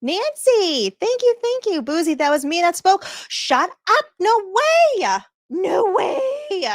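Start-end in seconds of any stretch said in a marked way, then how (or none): 3.3-6.7 thrilled no way; excited disbelief. Real, not acted. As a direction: squeal in happy disbelief. Reacts to chat news.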